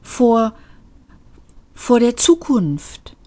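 high, slow, hesitant confession; falter, then sadly admit it. A narrator's reading. Lift confesses fear of the future, sad and halting. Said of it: "sagte der Fahrstuhl traurig".

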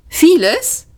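high, rising one-word question; startled echo, pitched right up; surprised. A narrator's reading. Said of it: "fragte Ford überrascht".

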